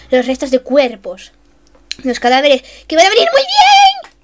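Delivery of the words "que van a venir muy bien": high, loud squeal of glee; gleeful squeal. Real, not acted. Gamer eyeing leftovers, squealing with glee. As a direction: squeal with greedy delight.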